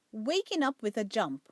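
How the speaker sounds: ordinary delivery, nothing marked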